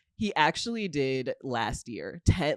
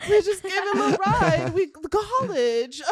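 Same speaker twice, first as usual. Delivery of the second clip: sweet cute act; 14 semitones higher, 4 dB louder than usual. Pretend. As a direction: play the innocent airhead for the cops. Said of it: "sweet cuteness and being like".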